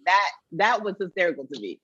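ordinary talk, nothing marked

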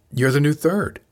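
mildly startled outburst; blurted. A narrator's reading. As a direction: blurt it out without thinking. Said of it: "she blurted".

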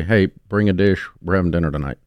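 ordinary talk

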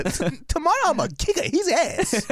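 put-on upset Japanese accent. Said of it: "she was so upset at the guy".

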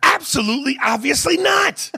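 high-pitched exasperated shout; worked-up, high comic yell; comic outrage. Real, not acted. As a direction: cry it out in exasperation. Mock outrage at a lazy accent.

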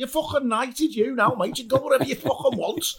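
high, fast teasing put-on voice; mock scouse banter. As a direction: rib him in a cheeky put-on voice.

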